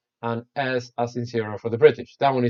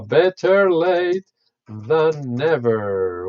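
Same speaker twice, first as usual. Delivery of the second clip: better late than never, drawn out word by word; slow careful dictation; 3 semitones higher, 4 dB louder, much slower than usual. Shown as a demonstration. Teacher writing the phrase for students, very slow and careful.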